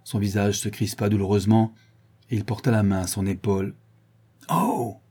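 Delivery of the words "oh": high yelp of pain; pained exclamation. A narrator's reading. Man gripped hard by the shoulder, crying out. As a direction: cry out as a shoulder is crushed. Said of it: "s'écria-t-il".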